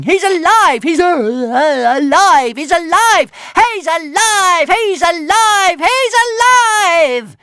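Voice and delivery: very high, loud, stretched-out squealing cry; dragon shouting. Acted. Toothless jumping on Hiccup, squealing joyful shouts. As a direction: shout it over and over, joy building to tears.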